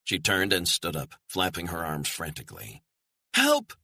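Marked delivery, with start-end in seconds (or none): 3.3-3.7 high, loud desperate scream; frantic shriek to a far-off figure; screaming for help. Acted. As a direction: scream across the distance for help. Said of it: "she screamed".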